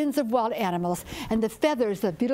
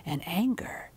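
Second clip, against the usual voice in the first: softer, whispered ending; hushed warning; 4 semitones lower, 5 dB quieter than usual. A narrator's reading. Storyteller ending the tale to listeners with a hushed warning.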